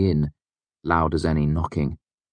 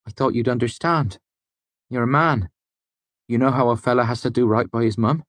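put-on higher, eager country-boy voice; a lightly raised young man's voice; young smith's prentice; 7 semitones higher than usual. A narrator's reading. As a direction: speak as an eager young lad. The boy complaining.

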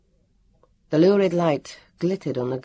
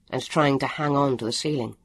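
ordinary delivery

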